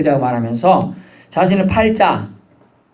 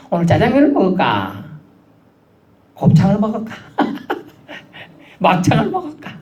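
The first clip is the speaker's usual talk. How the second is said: higher, laughing mock dithering over a menu; mock pondering; 5 semitones higher than usual. Preacher mimicking someone choosing lunch, laughing and higher. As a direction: play a man torn between dishes, chuckling. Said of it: "고민하는 것처럼".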